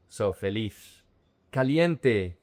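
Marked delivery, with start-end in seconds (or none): 0.3-1.0 final Z of "feliz" said as English TH; Spain pronunciation. Shown as a demonstration. Teacher shows how Spain says it, "feliz" ending in TH. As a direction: say "feliz" as feh-LEETH. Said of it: "it's pronounced like a f-, like a T H".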